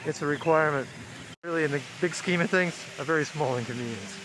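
muffled behind a mask; talking through a face mask. Real, not acted. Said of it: "Sorry if I sound muffled, I've got a mask on".

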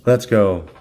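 normal talking voice

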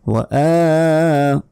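slow recited وآ; stretched aa. Shown as a demonstration. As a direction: hold the "aa" of وآ. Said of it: "আতে এক second".

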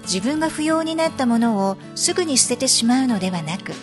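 ordinary delivery

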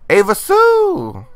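high, slow, loud drawn call. Real, not acted. Vlogger calling her Sim by name, drawn-out call. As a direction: sing out her name across the room.

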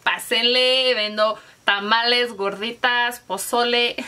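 loud calling-out street vendor, selling tamales; street food vendor call. Pretend. Vlogger playing a street vendor shouting to passersby, loud hawking call. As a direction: hawk your food loudly like a Mexican street vendor. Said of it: "Parezco esas que venden comida en la calle".

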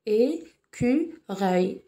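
"Écureuil" broken slowly into É, cu, reuil; split into syllables. Shown as a demonstration. Teacher shows the lone vowel is a sound, "Écureuil" syllable by syllable. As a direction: draw "Écureuil" out with gaps between its three syllables. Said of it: "nous avons trois syllabes".

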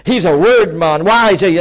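loud, scandalized protest; mock outrage at a fallen preacher; indignant religious critic. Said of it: "we would have said".

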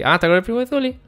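high, loud surprised question. Real, not acted. Streamer learns who is with Zuli's cousin, surprised. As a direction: jump up in pitch, taken by surprise.

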